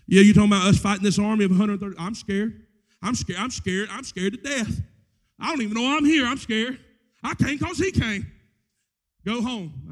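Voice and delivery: loud, fast, hammed-up panic; repeated frightened cries; mock scared. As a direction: play a terrified soldier for laughs. Acts a frightened soldier.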